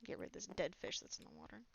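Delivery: whispered aside. Real, not acted.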